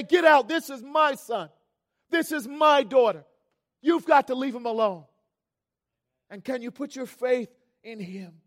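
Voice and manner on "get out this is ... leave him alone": loud, raised fatherly warning; forceful acted defence, pitched up; protective command. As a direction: drive the enemy off your child. Voices God warding off the enemy.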